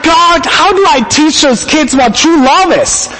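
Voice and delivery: retold cry of a frustrated parent. Frustrated dad pleading with God about the kids, exasperated. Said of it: "being so frustrated".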